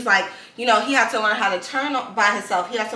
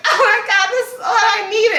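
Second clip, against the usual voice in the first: high and loud, gushing; overjoyed; 12 semitones higher, 5 dB louder than usual. Retold or imitated. Telling friends how church moved her, high joyful gush. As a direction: burst with joy, reliving church. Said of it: "I was like".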